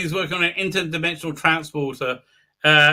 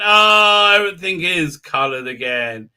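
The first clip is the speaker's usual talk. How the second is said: ordinary talk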